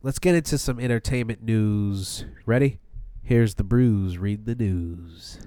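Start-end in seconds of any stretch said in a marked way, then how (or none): 4.2-5.4 announcer voice. Acted. Segment jingle intro.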